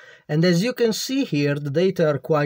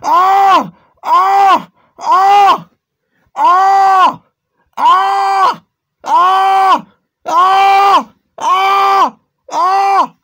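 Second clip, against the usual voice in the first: short high screams, one after another; a string of loud "Ah!" yells with short breaths between; repeated screams; 12 dB louder, much slower than usual. Pretend. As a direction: scream at the hard drive, over and over. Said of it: "gotta breathe".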